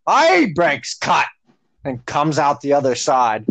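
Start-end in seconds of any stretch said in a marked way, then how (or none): 0.1-1.2 a slow, high mock yell; lightly hammed-up drunk excuse; imitating the drunk repairman. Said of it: "be like".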